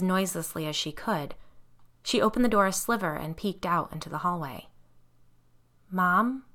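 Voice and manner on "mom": usual speaking voice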